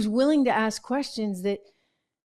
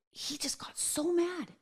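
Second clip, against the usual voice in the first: breathy gossip whisper, higher; hushed whisper; 8 semitones higher, 9 dB quieter than usual. Real, not acted. Host whispering the gossip about Chris getting mad. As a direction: drop to a whisper for the juicy bit.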